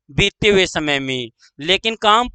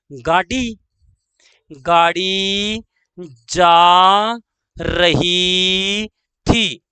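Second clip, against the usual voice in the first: drawn-out words with pauses between; slow dictation; much slower than usual. Shown as a demonstration. Teacher dictating the train example, slow dictation. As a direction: hold each word long for writing down.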